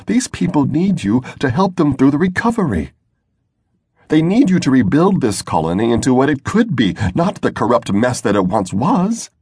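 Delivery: rally him like a believer, voice lifted high; impassioned Sarah. A narrator's reading. Sarah pleads with Drode.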